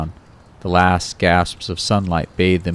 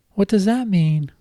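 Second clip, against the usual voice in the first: much higher girlish voice with a hint of a man underneath; a grown man faking a little girl, pitched way up; babyish high voice; 14 semitones higher than usual. Kyle faking Kate's voice on the phone to Ron, high and babyish. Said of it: "his Cuddly Kate voice holding".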